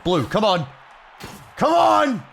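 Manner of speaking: from a call up to a high yell; building cheer. Real, not acted. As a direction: urge the fighter on harder.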